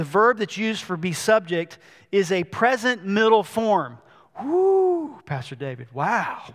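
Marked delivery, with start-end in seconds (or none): 4.4-6.4 mock cheer. A listener hailing Pastor David, mock excited whoop. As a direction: play an impressed listener cheering.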